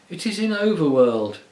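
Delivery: short of air, pushing the words out; out of breath. A narrator's reading. Puddleglum short of breath, resisting the witch's spell, gasping. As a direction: gasp it out, fighting for breath. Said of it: "struggling a little to get his breath".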